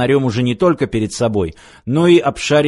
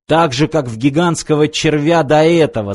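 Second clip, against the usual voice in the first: ordinary delivery, nothing marked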